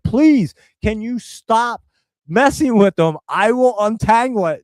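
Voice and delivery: acted-out, emphatic frustrated plea; exasperated plea. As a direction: plead with her to stop, at your limit. Acted exasperated plea to woman untangling hoses.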